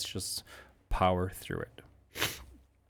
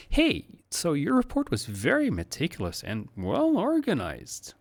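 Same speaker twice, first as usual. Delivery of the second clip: high, lifted, louder tune of praise; impressed; 8 semitones higher, 5 dB louder than usual. Shown as a demonstration. Manager praising a team member's report, impressed and high. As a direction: sound genuinely impressed by the report. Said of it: "Like you're impressed".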